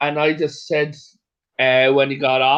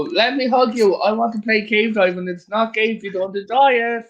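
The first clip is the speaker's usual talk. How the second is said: goofy mock chaser; 6 semitones higher than usual. Joking chaser voice after a mugger.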